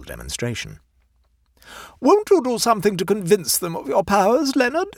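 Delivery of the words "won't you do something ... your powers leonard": high-pitched eager plea; pleading. A narrator's reading. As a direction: plead with him in a raised, high voice. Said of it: "she pleaded".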